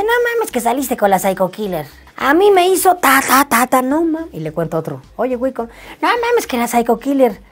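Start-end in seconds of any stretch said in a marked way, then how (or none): 0.0-4.3 high, animated mock astonishment; amigo alarmado imitado. Imita la reacción del amigo. 5.2-7.4 high-pitched, agitated friend imitation; otro amigo alarmado.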